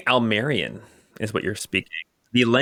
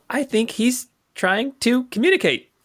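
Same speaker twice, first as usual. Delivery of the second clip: high, halting goblin voice; puzzled gobloid; 11 semitones higher than usual. Acted. Gobloid realising the captor wants to talk, puzzled. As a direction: work it out aloud in a high goblin voice.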